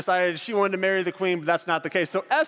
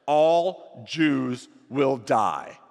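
grim decree; 4 semitones lower, much slower than usual. A narrator's reading. Reading the evil decree.

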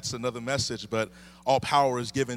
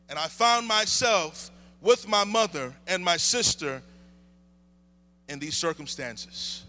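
higher, building preaching cadence; preaching testimony; 9 semitones higher, 4 dB louder than usual. Real, not acted. Pastor telling his childhood to the church, building and higher. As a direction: build each line of the story.

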